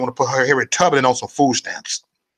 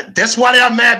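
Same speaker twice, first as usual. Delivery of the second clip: emphatic repeat; 9 semitones higher, 8 dB louder than usual. Shown as a demonstration. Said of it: "repeats line louder".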